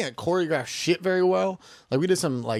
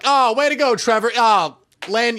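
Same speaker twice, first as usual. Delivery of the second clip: high, louder sarcastic blame; hammed-up exasperation; mock scolding; 7 semitones higher, 6 dB louder, slower than usual. Acted parents blaming baby holder.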